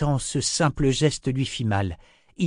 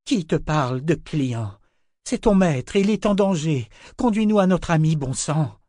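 rising to a loud, high angry plea; angry outburst; 7 semitones higher, 5 dB louder than usual. A narrator's reading. Nietzsche angrily urges the creature to lead them to Richard. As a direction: let the anger burst out louder line by line. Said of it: "serra les poings de colère".

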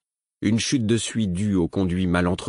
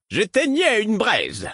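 high, slow, loud reassurance; half-deaf old man shouting; too loud; 4 dB louder, slower than usual. Acted. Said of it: "d'une voix trop forte".

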